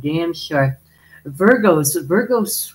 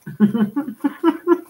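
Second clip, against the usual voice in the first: high run of giggles; real giggle; 10 semitones higher than usual. Real, not acted. Amused at her own innuendo, high giggling. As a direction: giggle helplessly, pitched well up.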